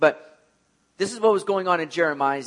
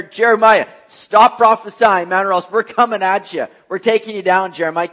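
mock threatening townsfolk; 3 semitones higher, 8 dB louder than usual. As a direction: bark it at Jeremiah like an angry mob. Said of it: "They're all sitting here".

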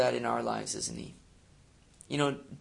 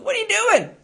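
high, loud, exasperated king; much higher indignant royal squeal; king of Moab; 11 semitones higher, 7 dB louder than usual. Retold or imitated. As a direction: shriek it like a frantic king who paid for curses. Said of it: "the king of Moab was sitting there going".

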